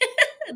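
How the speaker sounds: embarrassed laugh at herself. Real, not acted. Laughs at own bad accent.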